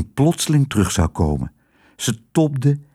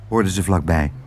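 ordinary delivery, nothing marked

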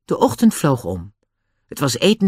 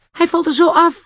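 high startled call; alarmed cry; 3 dB louder than usual. Acted. Annie watching Nobby stand on the trotting horse, high alarmed cry. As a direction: cry out in worry. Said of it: "riep Annie".

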